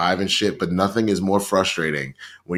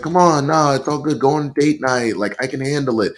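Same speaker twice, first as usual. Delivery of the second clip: mock reassuring; 5 semitones higher than usual. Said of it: "he was like".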